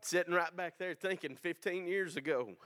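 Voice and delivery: ordinary delivery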